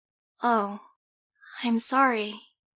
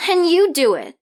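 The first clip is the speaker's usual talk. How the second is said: loud, higher indignant retort; louder, higher, indignant; 5 semitones higher, 8 dB louder than usual. Acted. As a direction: throw it back at her. Snapping back.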